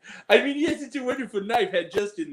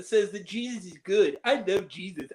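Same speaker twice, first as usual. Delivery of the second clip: ordinary delivery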